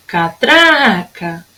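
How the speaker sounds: "Catraca" slowly, with a long "tra"; slow "Catraca", lingering on "tra"; stretched middle syllable. Shown as a demonstration. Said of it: "Tem o som mais forte aqui".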